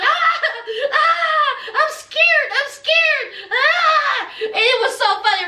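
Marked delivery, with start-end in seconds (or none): 0.0-4.2 hammed-up frightened cries; a grown man shrieking at monkeys, mocked; mock scared yelp. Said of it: "he was going".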